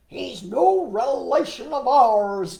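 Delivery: loud, slow frog denial; drawn-out, forceful storybook protest; emphatic declaration. Acted. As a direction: declare it indignantly as the frogs, stretching the words. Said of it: "declared the frogs".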